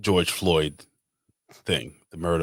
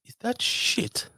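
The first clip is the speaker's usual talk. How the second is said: mock English accent; 11 semitones higher than usual. Host playing the Queen of England in a mock English accent. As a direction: play the Queen with a hammed-up high English accent. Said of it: "I can't do a real English accent".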